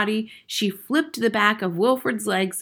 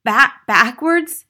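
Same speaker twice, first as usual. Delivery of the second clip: slow, high, loud stammer; flustered schoolboy stutter; 6 semitones higher, 11 dB louder, slower than usual. A narrator's reading. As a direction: stumble on the word in dismay. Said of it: "stammered Wilford".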